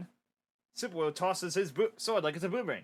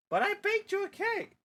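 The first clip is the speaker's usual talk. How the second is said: much higher coaxing mom voice; 14 semitones higher than usual. Acted. Mom coaxing her son about dinner, high pleading. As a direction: coax like a mother.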